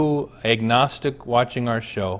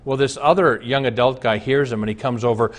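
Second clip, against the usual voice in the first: ordinary delivery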